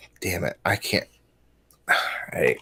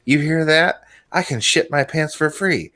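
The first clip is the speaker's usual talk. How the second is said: customer voice; 7 semitones higher than usual. Retold or imitated. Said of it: "the guy turns around ... and says".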